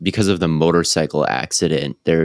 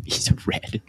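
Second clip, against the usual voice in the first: whisper; 8 dB quieter than usual. Real, not acted. Echoing a co-host in a whisper.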